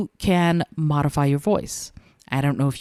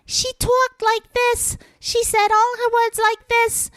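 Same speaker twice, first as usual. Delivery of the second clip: very high, squeaky, breathy-girl impression; Audrey from Little Shop of Horrors; slower than usual. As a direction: squeak it out like the flower-shop heroine. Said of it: "Audrey, you know".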